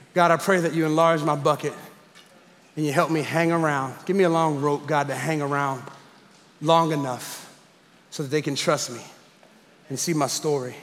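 soft, low spoken prayer. Acted. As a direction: pray it quietly, drawing the words out. Speaker prays aloud.

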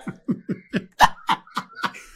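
high, loud burst of laughter; real laughing fit at the joke; big laugh. Real, not acted. Laughing at the yelling back joke.

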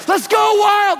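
loud, high poolside yell; over-the-top proud-dad cheer. Retold or imitated. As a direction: whoop it out like cheering an Olympian. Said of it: "because I cheer for my son".

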